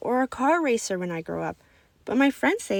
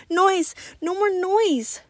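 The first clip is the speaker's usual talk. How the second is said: high, loud distressed cry; 10 semitones higher, 4 dB louder, much slower than usual. Acted. Boy in echoing cafeteria cries out, high and loud. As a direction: plead for the noise to stop. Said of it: "Lucas cried".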